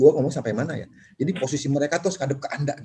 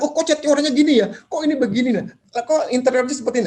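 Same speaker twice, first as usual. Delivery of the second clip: picky visual client; 10 semitones higher than usual. Acted. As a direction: fire off nitpicks about the paint. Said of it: "Dia bicara".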